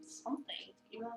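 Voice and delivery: hushed, breathless whisper; a voiceless whispered question. Acted. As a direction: breathe it as a secret aside. Suspicious aside about coworker.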